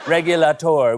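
mock French. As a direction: say Regulator with a hammy French accent. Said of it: "French for regulator".

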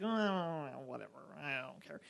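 quiet, shrugging don't care; dismissive. Retold or imitated. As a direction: toss it off softly, bored. Acting out not caring about Josh.

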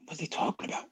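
a soft aside whisper to himself; whispered thought. Retold or imitated. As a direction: breathe it under your breath, puzzled. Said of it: "And I'm like".

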